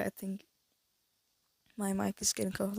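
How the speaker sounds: ordinary talk, nothing marked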